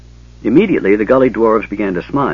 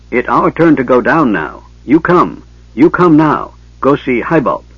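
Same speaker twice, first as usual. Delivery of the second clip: higher, louder little dwarf urging someone along; insistent gully dwarf; 5 semitones higher, 5 dB louder than usual. A narrator's reading. Gully dwarf tugs the mage to go down, insistently. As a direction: push them to come, in short emphatic bursts.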